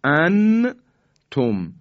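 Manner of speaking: انتم in two loud beats with a clear n; high, emphatic انتم with the n fully pronounced; izhar, wrong reading. Shown as a demonstration. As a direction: sound the n of انتم firmly, tongue touched, then تم. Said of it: "این اظهاره".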